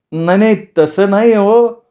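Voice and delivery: high, drawn-out plaintive voice; pleading protest. Acted. Teacher tries to correct the mocking villagers, high pleading protest. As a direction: protest meekly, stretching the last words.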